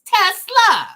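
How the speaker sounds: "Tesla" high and loud as Tes-la; two-syllable Tesla. Shown as a demonstration. Host settles the name in two syllables, emphatic Tes-la. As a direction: punch out "Tesla" in two clean beats, Tes-la. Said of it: "Uh, two syllables".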